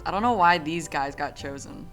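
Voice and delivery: ordinary talk, nothing marked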